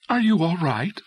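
high, worried question; high concern. A narrator's reading. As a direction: check on him with anxious concern. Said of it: "Concern was high in her voice".

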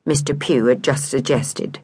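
ordinary delivery, nothing marked

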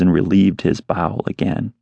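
plain reading voice

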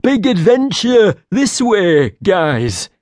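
a hammed-up simple creature announcing adventure; simple creature announcement. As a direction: call the others on, slowly. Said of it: "Nita stated".

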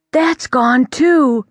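can't believe his eyes; stunned. Acted. The prince watches his hanky vanish, stunned.

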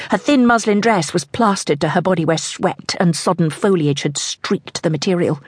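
ordinary delivery, nothing marked

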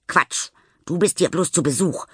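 put-on high, venomous child's jab; spiteful. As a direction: spit it out to hurt her. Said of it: "giftet Nelly".

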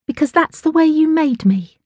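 high-pitched defiant daughter. A narrator's reading. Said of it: "tightening her ponytail defiantly".

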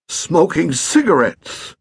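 fussy stepfather scolding; testy old gentleman. Acted. Pett accuses.

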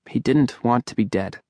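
plain reading voice

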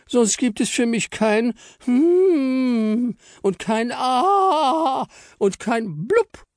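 very high, slow tearful wail; squeaky sobbing. Acted. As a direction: whimper it out crying. Frau Stern breaks into tears.

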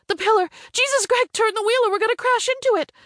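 high-pitched, urgent cry of alarm; panicked warning. A narrator's reading. Passenger seeing the car head for a pillar, panicked. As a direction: warn the driver in a high panic. Passenger warns of imminent crash.

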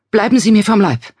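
high, loud panicked cry; frightened shout. A narrator's reading. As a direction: shout him away in fear. Frightened woman fends off stranger. (panisch laut rufend)

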